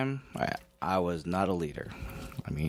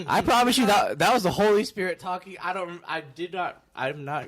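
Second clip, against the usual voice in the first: high, loud, amused insistence; emphatic protest; 8 semitones higher, 7 dB louder than usual. Real, not acted. Guest defending his bold soda request, high and emphatic. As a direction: protest loudly, pitch jumping way up.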